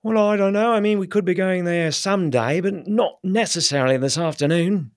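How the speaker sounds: very high, fluting English middle class hedge; an English middle class gent politely stalling; English middle class, unsure and noncommittal; English middle class accent. Shown as a demonstration. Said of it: "English middle class".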